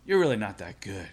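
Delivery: low, quiet letdown; understated, low-pitched mock put-down; amused put-down. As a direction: imitate the coach gently dismissing a novice, low and soft. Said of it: "he's just laughing at me going".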